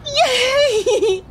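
squealing with joy, high and laughing; thrilled and laughing at the news; excited. Acted. Just passed the audition.

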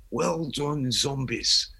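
lightly attempted Liverpool accent; half-hearted Liverpool impression, a little understated. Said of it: "I can't really do a Liverpool accent".

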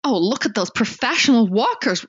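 attempted American accent, badly done. Shown as a demonstration. Co-host imitating American tourists on the trail, wobbly American accent. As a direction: have a shaky go at sounding American. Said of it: "I can do American accent".

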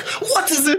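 high, pleading question; scared mom again. Retold or imitated. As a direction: mom begging to know. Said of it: "She's like".

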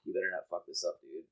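very low, hushed self-warning; muttered to himself. Acted. Vasilius psyching himself up privately, low quiet mutter. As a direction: mutter it under your breath, pitched right down. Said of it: "Like, to himself".

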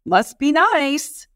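high, drawn-out sarcastic envy; mock resentment. Catching yourself envying others, mock-resentful. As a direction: draw it out, acting envious. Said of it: "anytime you catch yourself saying".

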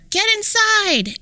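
high, drawn-out warning call; slow, high holler of alarm; knights hollering. A narrator's reading. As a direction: yell it to get everyone indoors. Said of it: "the knights would holler".

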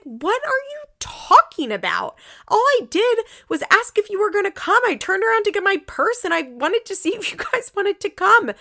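indignant retort. Retold or imitated. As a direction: play the wife defending herself, fed up. Retold wife defends herself.

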